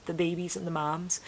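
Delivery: usual speaking voice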